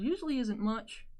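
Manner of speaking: ordinary talk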